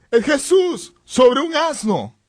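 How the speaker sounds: high, drawn-out amazed exclamation; asombro imitado. Retold or imitated. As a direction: cry it out like onlookers stunned by the sight. Onlookers recognize who rides the donkey. (exclamación de asombro, aguda y alargada)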